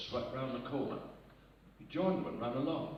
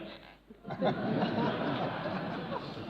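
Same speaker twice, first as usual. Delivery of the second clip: breathless laugh; 9 semitones higher than usual. Real, not acted. Laughing mid-joke.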